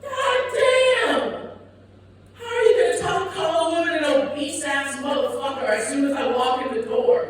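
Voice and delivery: very high, indignant outburst; outraged retort. Acted. Comic snapping back at a fellow comedian's insult, high-pitched mock outrage.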